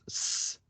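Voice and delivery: soft hissed sss, no voice; pure hiss of an s; voiceless S. Shown as a demonstration. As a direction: hold an s without any buzz. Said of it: "and the S, it doesn't".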